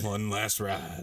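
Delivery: slow, weighty mock action-hero line; a light laughing Dom Toretto imitation; Dom Toretto impression. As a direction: echo it in a slowed movie-star voice. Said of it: "As Dom, Vin Diesel, Dom Toretto would say".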